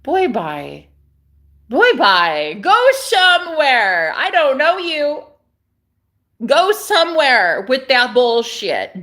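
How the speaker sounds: loud, slow, drawn-out brush-off; dismissive sass. Acted. Waving off her boyfriend's god talk, loud and sassy. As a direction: shoo him away with attitude.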